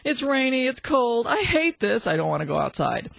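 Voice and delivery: the dog moaning about rain; dog's inner voice. Shown as a demonstration. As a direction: voice the dog's reluctant thoughts. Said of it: "your dog will be thinking".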